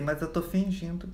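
very soft, slow aside; secret thought. Acted. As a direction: murmur it to yourself while playing unconscious. Secret thought while faking a faint.

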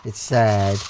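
low, glum playful voice; playfully downcast; mock sad. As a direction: drop low for a pretend sad face. Watching face flip sad, happy.

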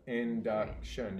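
"Induction" said slowly, syllable by syllable. Shown as a demonstration. Teacher modelling the chin trick for the student, slow and careful. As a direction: stretch "Induction" into three clear beats. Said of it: "You can feel your jaw".

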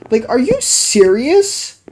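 slow, high disbelieving question; exasperated mock incredulity; incredulous. Real, not acted. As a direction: drag out the disbelief. Outraged at unfair success.

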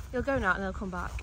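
usual speaking voice

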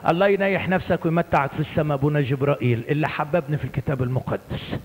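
ordinary delivery

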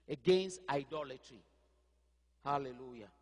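ordinary delivery

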